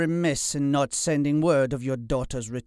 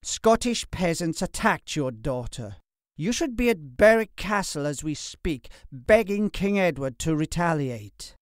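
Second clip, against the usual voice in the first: raised, forceful lordly voice; higher, louder, accusing; haughty reproach; 5 semitones higher, 5 dB louder than usual. Acted. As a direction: lecture him as an indignant nobleman. Haughty lord confronts Bella's father.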